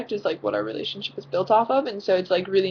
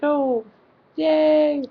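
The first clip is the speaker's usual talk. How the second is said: high, forced "Oh, yay"; fake cheer; 8 semitones higher, 4 dB louder than usual. Greeting the boy who walked into her room, with a sarcastic chirp. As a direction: chirp it with hollow enthusiasm. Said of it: "I was like".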